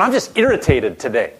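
mock grumble. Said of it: "can you see Jesus going".

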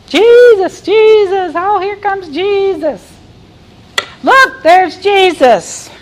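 high-pitched excited onlookers calling; crowd yelling out. Acted. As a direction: imitate fans spotting someone famous pass by. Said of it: "yelling out the name of Jesus".

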